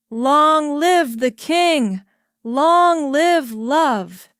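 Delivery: loud, slow drawn-out chant; crowd cheer. A narrator's reading. Crowd in the dark streets cheering the king.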